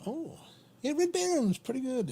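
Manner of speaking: high, bright burst of approval; excited agreement. Real, not acted. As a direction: jump up in pitch, delighted. Enthusiastic reply to Tony.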